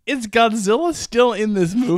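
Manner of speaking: high, loud mock disbelief; incredulous exasperation. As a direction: ask it as a fed-up, baffled viewer. Acting out exasperated viewer's thought.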